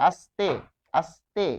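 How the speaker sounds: আস্তে with a clean dental s; dental s demo. Shown as a demonstration. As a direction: hiss the s in আস্তে. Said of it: "দন্ত স থেকে গেছে". (আস্তে দন্ত স উচ্চারণে)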